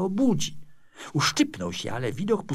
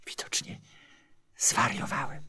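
muttering; 2 semitones lower, 3 dB quieter than usual. A narrator's reading. Sculptor to himself after the statue leaves, muttered. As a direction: mumble it quietly to yourself. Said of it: "mruknął".

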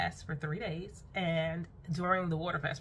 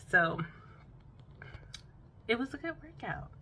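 ordinary delivery

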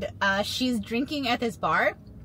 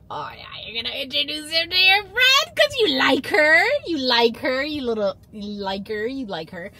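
higher, louder mock teasing; teasing singsong; 3 semitones higher, 4 dB louder than usual. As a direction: needle him with a playful higher taunt. Teasing him about his crush.

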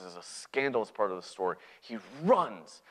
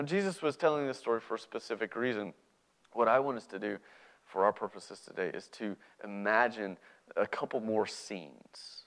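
ordinary delivery

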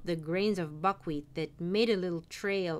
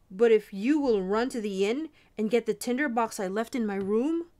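raised voice, urgent calling out; shouting; 3 semitones higher, 6 dB louder than usual. A narrator's reading. Soldier bargaining with the apprentice from his cell, shouting. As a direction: call down to someone in the street. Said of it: "shouted the soldier".

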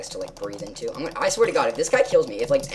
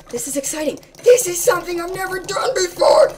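high, squealing thrill; bursting with glee, way up high; giddy squeal of excitement; excited; 11 dB louder than usual. Real, not acted.

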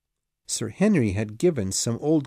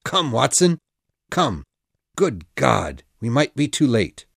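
urgent call; 5 dB louder than usual. A narrator's reading. Holmes urges running to victim.

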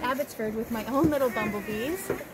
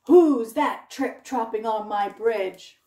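gruff troll; 2 semitones lower, 8 dB louder, slower than usual. Acted. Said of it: "and said".

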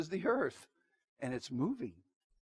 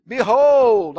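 Behold thundered out loud; a sudden ringing cry from the pulpit; booming proclamation; 4 semitones higher, 15 dB louder than usual. Acted. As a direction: proclaim it like a herald. Preacher reading Jesus' warning aloud.

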